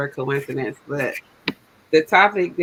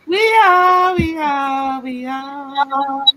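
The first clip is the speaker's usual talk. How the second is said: high, loud, delighted; excited; 8 semitones higher, 6 dB louder, much slower than usual. Real, not acted. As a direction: bubble over with joy. Excited reply, live.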